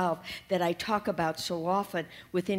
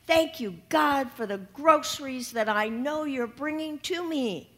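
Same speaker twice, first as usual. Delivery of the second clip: loud thanks; 5 semitones higher, 4 dB louder than usual. Acted. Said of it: "and she says".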